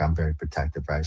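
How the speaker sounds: normal talking voice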